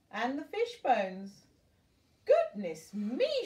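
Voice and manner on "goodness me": higher, louder, slow astonished cry; lady exclaims at her hat in the mirror; exclaiming. A narrator's reading. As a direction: stretch out the shock. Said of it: "she exclaimed".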